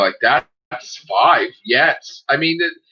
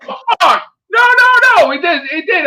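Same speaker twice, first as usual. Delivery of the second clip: much higher, louder, in mock outrage; excited disbelief; 7 dB louder than usual. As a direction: squeal it in amused disbelief. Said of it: "I'm like".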